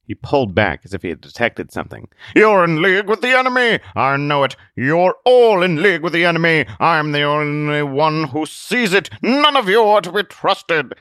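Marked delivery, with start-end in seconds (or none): 2.4-11.0 high, loud paranoid accusation; paranoid ranting hermit. Acted. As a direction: rant like a cornered paranoid recluse.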